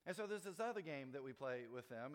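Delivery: ordinary talk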